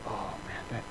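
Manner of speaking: awed and affectionate; a hint of God moved by it; tender praise. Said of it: "He says".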